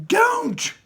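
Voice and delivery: high, loud panicked scream. Acted. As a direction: shriek to stop her. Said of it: "screamed Mister Wonka".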